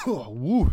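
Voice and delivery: hack, then blow out relief; coughing up water. Real, not acted. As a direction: cough then a breathless woo. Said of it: "that went down the wrong pipe".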